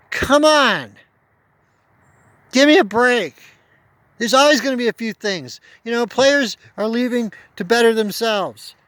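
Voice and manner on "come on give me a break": exasperated. Real, not acted. Exasperated rant at fans.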